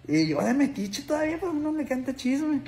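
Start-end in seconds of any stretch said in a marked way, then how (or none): none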